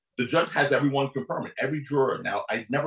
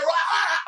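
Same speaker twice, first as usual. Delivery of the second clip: retold cry of dismay. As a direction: yelp in alarm. Group cries out at verdict.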